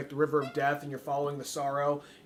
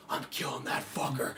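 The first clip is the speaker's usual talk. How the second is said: whispered, lower and softer; mock whisper; 5 semitones lower, 6 dB quieter than usual. Gamer spotting a soldier, a low whisper. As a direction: hiss it like a sneaky gamer. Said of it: "I'm like".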